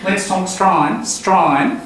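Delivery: broad Australian Strine through the nose; nasal Aussie Strine, drawn out; Australian accent. Shown as a demonstration. Teacher showing Strine means Australian. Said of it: "That's Australian".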